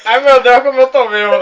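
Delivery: put-on drunk wail. Shown as a demonstration. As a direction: shows how to wail it loud and high. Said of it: "Fala que".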